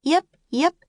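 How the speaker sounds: high, loud little puppy yips. Acted. The puppy yipping as it chases the ball. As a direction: yip like an excited puppy.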